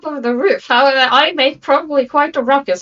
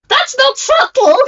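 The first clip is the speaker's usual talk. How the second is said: high shriek; 14 semitones higher, 3 dB louder than usual. Acted. Character shrieks at bomb.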